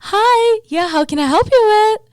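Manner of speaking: very high, loud chirpy service voice; Valley Girl voice. Retold or imitated. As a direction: put on the bubbly barista. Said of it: "when I do this whole".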